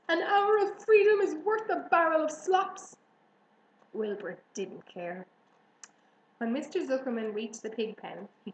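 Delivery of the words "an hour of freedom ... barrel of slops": high, loud pleading argument; honking goose. A narrator's reading. Goose pleading for pig's freedom, honking. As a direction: argue at a honk. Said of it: "honked the goose".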